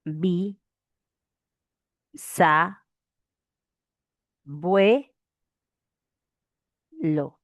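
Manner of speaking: "Bi-sa-bue-lo" with long pauses between syllables. Shown as a demonstration. Teacher spells out bisabuelo's syllables, pausing between each. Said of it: "¿cómo será?".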